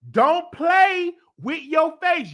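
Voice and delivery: slow, high, loud scolding; scolding shout. Real, not acted. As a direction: scold them, draw it out. Scolding viewers about cosmetic surgery.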